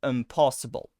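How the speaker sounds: "impossible" with a relaxed schwa in the middle; schwa pronunciation. Shown as a demonstration. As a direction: say "impossible" the natural reduced way. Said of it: "something more like an".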